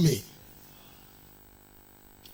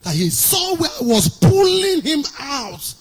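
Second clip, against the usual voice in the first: raised, higher preaching voice; impassioned retelling; 5 semitones higher than usual. Retold or imitated. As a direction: build intensity as if hauling someone out. Preacher dramatizes pulling someone from death.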